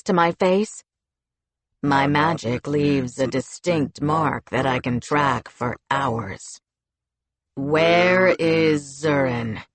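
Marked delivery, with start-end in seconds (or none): 1.8-9.6 low, slow, cutting commander voice; cold menace, slowed right down. Acted. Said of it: "He had a cutting edge to his tone".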